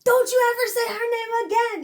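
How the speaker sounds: high, furious yell; going off. Acted. Daughter warning her father about her mother, furious shout. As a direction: explode in a loud, high-pitched outburst. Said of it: "Yeah, she went off".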